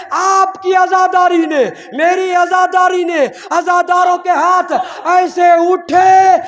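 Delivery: very high, very loud shouted oratory; fiery climax preaching. Acted. Preacher naming Husain's killers to mourners, shouting. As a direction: rage at the killers at the top of your voice.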